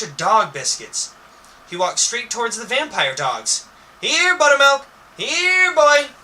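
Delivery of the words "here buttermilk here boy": high, loud call to a dog. A narrator's reading. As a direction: call the dog over, much higher and louder. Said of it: "he crawls".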